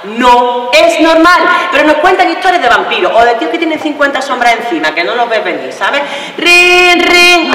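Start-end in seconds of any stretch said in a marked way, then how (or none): 0.0-1.4 "No es normal" drawn out slowly, higher; emphatic declaration. Acted. Woman declaring fear of a lover wrong, "No es normal" slow and emphatic. Repeats her verdict to audience. 6.4-7.6 high, loud mimicked ringtone; imitated phone ring. Performer acting out a phone interrupting, imitated ring.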